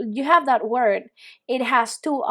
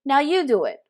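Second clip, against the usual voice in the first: ordinary talk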